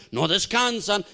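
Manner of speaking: ordinary talk, nothing marked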